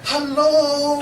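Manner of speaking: long, high mock holler; drawn-out, high-pitched comic hello; laughing call-out. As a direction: call across to a friend, amused. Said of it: "I'm busting out laughing".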